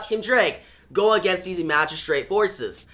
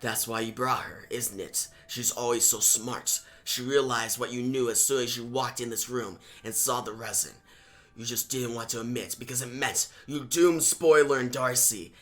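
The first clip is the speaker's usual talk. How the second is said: low, soft, cold voice of a doubting mentor; deep hushed taunting mentor; Batman; 6 semitones lower, 6 dB quieter than usual. Acted. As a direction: murmur it far lower, like a haunting voice in his head. Voices Batman in Tim's head.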